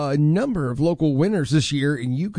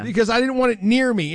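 normal talking voice

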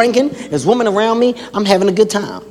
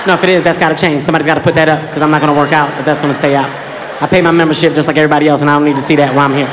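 deadpan complaint; 6 semitones lower, faster than usual. Retold or imitated. Nephew's deadpan complaint to gym.

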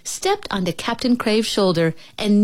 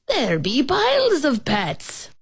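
high, drawn-out exclamation; exclaiming pirate captain; 7 semitones higher than usual. A narrator's reading. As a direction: marvel slowly in a raised pitch, as a pirate captain. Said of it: "Captain Crave exclaimed".

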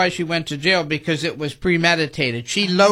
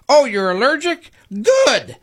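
higher, snide and gleeful; spiteful; 6 semitones higher than usual. Retold or imitated. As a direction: sneer it with mock delight. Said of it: "Woods reportedly said, quote".

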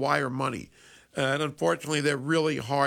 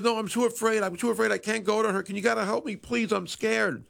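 put-on higher, pleading boy's voice; scared grandson; 7 semitones higher than usual. Scam caller begs grandma for help, mock scared and higher. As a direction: beg grandma like a frightened kid. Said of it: "And he goes".

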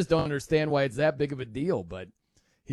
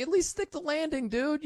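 pep talk to a teammate; 9 semitones higher, 3 dB quieter than usual. Retold or imitated. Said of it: "You're like".

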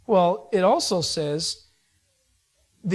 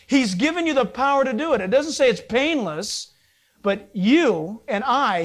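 normal talking voice

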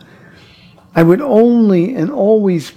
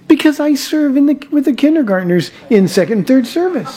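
put-on boy's voice; 7 semitones higher than usual. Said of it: "here's what he said".

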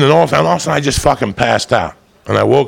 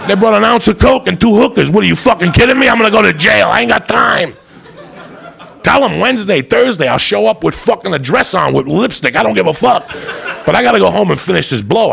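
frantic pleading; 7 semitones higher than usual. Retold or imitated. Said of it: "I say".

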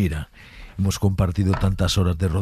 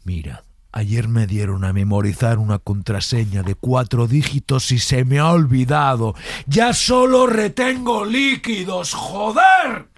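building to angry; 4 semitones higher than usual. Shown as a demonstration. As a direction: start calm, explode on joder. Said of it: "del susurrado al enfadado".

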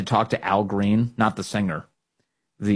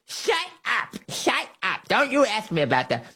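very high mocking politician impression; Nancy Pelosi; 11 semitones higher than usual. Host mimicking a politician dodging January sixth questions, mock upset. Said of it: "That was Nancy Pelosi's version of that".

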